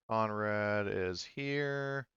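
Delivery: ordinary talk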